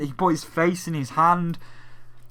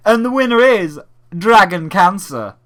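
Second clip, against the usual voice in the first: loud, higher grand announcement; mock announcer; 4 semitones higher, 7 dB louder than usual. Mocking the host reading out the winner, loud. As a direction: announce the winner with fake gusto. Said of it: "And then he's like".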